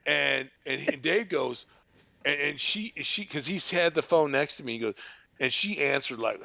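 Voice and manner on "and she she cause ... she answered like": normal talking voice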